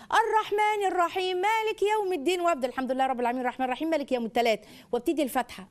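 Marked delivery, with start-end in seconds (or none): 0.1-2.4 high, slow recitation of الرحمن الرحيم مالك يوم الدين; slow teaching recitation. Shown as a demonstration. Mother teaching the verse to her toddler, slow high recitation. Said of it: "الآية". 2.7-4.6 put-on fast, jumbled child recitation; child's garbled recital. Toddler rushing through the verse with a mistake, fast mimicry.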